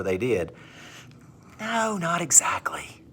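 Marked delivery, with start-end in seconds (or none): 1.6-3.0 slow, soft whispered no; whispered correction. Acted. Teacher whispering the correction to the class. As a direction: let them down gently in a whisper.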